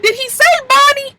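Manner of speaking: surprised. Real, not acted. Narrator shocked at fake name.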